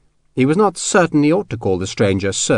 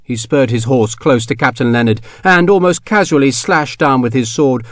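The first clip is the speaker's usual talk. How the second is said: usual speaking voice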